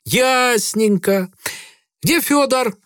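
put-on slow, high insolent drunk sailor. A narrator's reading. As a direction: drag it out like a drunk. Drunk sailor Грицай voiced. (пьяный матрос)

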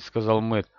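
usual speaking voice

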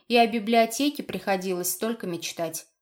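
ordinary delivery

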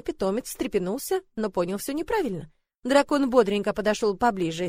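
ordinary delivery

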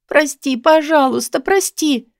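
high, acted tearful apology; crying plea. A narrator's reading. Woman apologising as he pulls her close, crying. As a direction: keep begging through imagined tears. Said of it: "продолжала плакать я".

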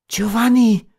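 high cry of his name; called out. A narrator's reading. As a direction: cry out to him, pitch leaping. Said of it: "rief Beatrice".

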